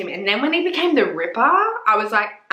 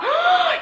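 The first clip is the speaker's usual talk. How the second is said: excited gasp. Real, not acted. Thrilled at mentioning Lucifer.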